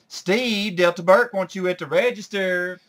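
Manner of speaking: loud high holler across the store, calling out to Steve. Retold or imitated. Charlene hollers to Steve in the stock room, loud and high. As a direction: call out high and loud so Steve can hear. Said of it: "Dipstick over here hollers out".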